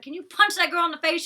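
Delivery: high, loud urging; fired-up viewer egging a fighter on; yelling at the TV. Real, not acted. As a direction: shout encouragement at the screen.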